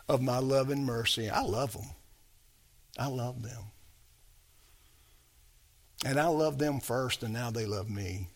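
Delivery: low, hushed declaration of love; God's tender love. Acted. God speaks of those he saved, quietly loving. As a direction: drop down soft and let it sink in.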